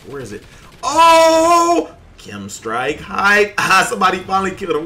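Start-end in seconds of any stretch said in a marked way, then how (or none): 0.8-1.9 long, high, very loud "oh"; triumphant scream. Real, not acted. Gets the Chem Strike. 2.2-4.8 loud, high celebration breaking into laughter; triumphant hype. Real, not acted. Celebrates the killstreak.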